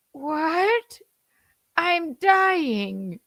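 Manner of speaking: high, far put-on Italian accent; mock Italian Pope. Son dubs the Pope dying in mock Italian accent. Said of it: "the Pope says in Italian".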